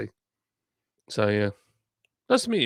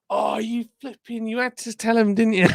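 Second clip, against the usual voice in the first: high, lightly voiced mock scolding; [name] teasing; 10 semitones higher, 4 dB louder than usual. Friend scolding across the keyboard, high mock reproach. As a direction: tease him like a friend scolding you for telling. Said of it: "side of this keyboard going".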